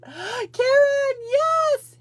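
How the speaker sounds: high, loud excited cry; gasp, then whoop with joy as the guest appears; delighted greeting. Real, not acted. Host greeting her awaited guest as she joins, thrilled.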